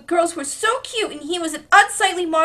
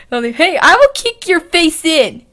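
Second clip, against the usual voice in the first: put-on Beast Boy threat; 3 semitones higher, 11 dB louder than usual. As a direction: bark it as a tough Beast Boy. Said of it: "Should be Beast Boy".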